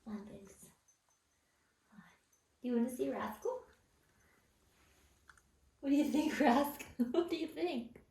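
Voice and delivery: ordinary delivery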